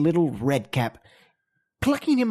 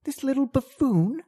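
scornful; 7 semitones higher than usual. Acted. Cat character scoffs at creature.

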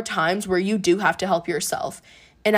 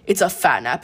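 ordinary talk, nothing marked

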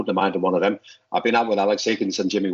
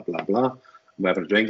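ordinary delivery

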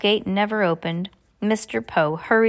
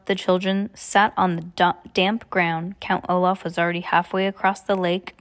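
plain reading voice

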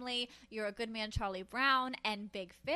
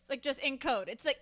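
usual speaking voice

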